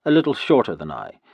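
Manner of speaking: ordinary delivery, nothing marked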